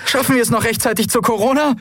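fast, high strained croak. Acted. Endriel in smoke asking her pilot, fast high croak. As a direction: croak the question urgently. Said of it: "krächzte sie".

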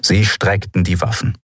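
usual speaking voice

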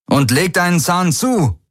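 high, urgent shout; calling back. A narrator's reading. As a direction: call back to hurry him up. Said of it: "rief er".

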